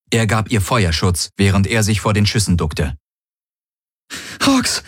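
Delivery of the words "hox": high-pitched desperate plea; pleading. A narrator's reading. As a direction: beg him by name, high and desperate. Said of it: "flehte Kirai".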